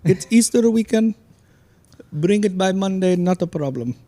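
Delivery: put-on Indian accent, pitched well up; the gas station guy in an Indian accent. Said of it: "I'm gonna do an Indian accent".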